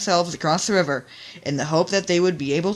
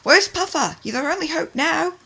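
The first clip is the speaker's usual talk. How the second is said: desperate; 12 semitones higher, 3 dB louder than usual. Acted. Desperate calling out.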